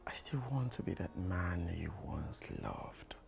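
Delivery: soft tender murmurs; whispered pleading. Acted. Man whispering his love to Angie, pleading. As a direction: whisper your love to win her back.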